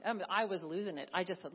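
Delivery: ordinary delivery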